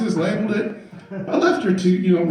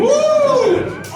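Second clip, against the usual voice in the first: high loud whooping woo; mock crowd woo; 4 dB louder than usual. Courtroom reacting to the daughter, loud high whoop.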